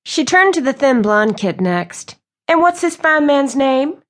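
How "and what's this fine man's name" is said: high, sweet flirting voice; flirty Southern belle. Acted. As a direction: bat your lashes and coo it. Reese flirts with Southern charm.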